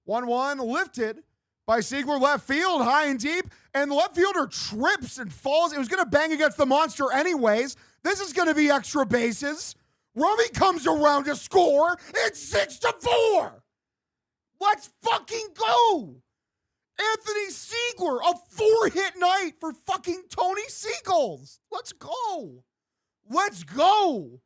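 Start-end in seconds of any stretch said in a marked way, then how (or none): 2.2-13.6 excited call. Real, not acted. Fan calling an extra-base hit. 14.6-24.4 frat bro. Real, not acted. Hyped cheering for Siegler.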